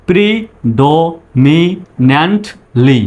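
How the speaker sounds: drawn out syllable by syllable; slow teacher demo; slow broken up. Shown as a demonstration. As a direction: say it in slow pieces. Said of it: "আগে ভেঙে ভেঙে উচ্চারণ করবেন".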